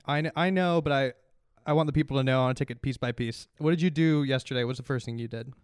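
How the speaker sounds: normal talking voice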